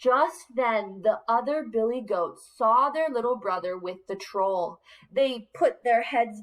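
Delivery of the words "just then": usual speaking voice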